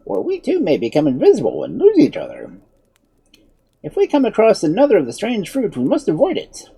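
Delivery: high-pitched, earnest wizard; cautioning wizard. A narrator's reading. Wizard warning children about the strange fruit, cautioning.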